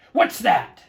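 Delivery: loud, high, sharp snap; snapped question. Acted. Yertle hearing a sigh from below, loud irritated snap. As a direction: bite the words off irritably. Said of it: "snapped the king".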